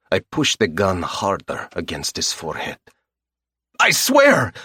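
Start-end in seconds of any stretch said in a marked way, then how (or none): 3.8-4.5 high, loud terrified squeal; squealing in fear. Acted. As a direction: squeal it out, panicked, at gunpoint. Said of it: "he squeals".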